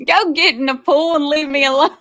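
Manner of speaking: high-pitched mock scolding through laughter; laughing banter. Real, not acted. Wife sends husband to the pool, laughing as she scolds. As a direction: shoo him off while cracking up.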